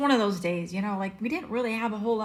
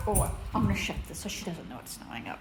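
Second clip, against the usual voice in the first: ordinary talk, nothing marked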